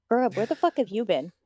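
normal talking voice

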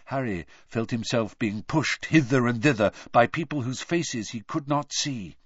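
ordinary delivery

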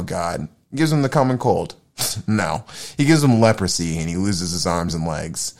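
usual speaking voice

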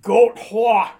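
loud, high New York drawl; New York accent. Shown as a demonstration. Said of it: "That's funny to say with my New York accent".

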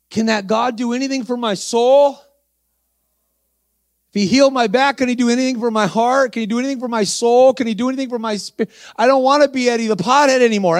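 man just healed, crying for his soul; tearful pleading. Retold or imitated. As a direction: plead through tears. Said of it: "He's crying".

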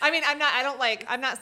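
loud laughing protest; high, loud, laughing; laughing loudly. Real, not acted. Laughing burst.

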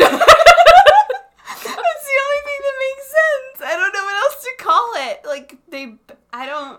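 high, cracking laughter; laughing till tearing up; laughing hard. Real, not acted. Defends "mooing," cracking up.

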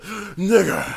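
high, loud mock shout; exaggerated imitation of a shouter; imitating the video. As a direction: throw it out high, as a hammed-up imitation. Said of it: "he's like".

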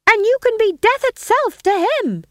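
high, loud taunting wife; scheming wife voice. Acted. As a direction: egg him on in a shrill stage voice. Gumridge as Lady Macbeth.

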